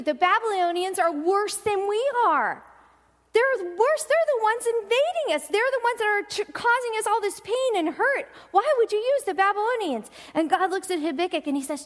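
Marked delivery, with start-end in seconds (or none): 0.0-10.1 indignant prophet. Retold or imitated. Prophet protesting to God.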